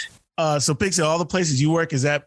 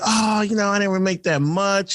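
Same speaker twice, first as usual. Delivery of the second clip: dancer making excuses; 5 semitones higher than usual. Retold or imitated. Dancer excusing a small tip to DJ, put-on excuse. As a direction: imitate a dancer dodging with a high voice.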